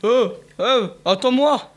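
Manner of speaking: high, calling hound dog. Acted. Hound calling to the rabbit, high and urgent. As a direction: call after the fleeing rabbit.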